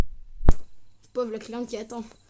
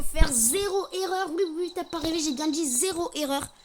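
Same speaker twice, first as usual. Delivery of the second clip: excited emphasis; 5 semitones higher, 7 dB louder than usual. Real, not acted. Hyping video goal.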